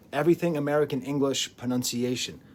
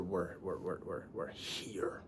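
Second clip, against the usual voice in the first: fading whisper; 7 semitones lower, 13 dB quieter, slower than usual. Acted.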